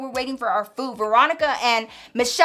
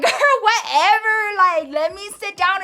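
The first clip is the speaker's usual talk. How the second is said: higher, laughing it off; 6 semitones higher than usual. Retold or imitated. Michelle brushing off her angry friend, laughing lightly. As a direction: shrug it off with a giggle. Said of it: "thought she was playing".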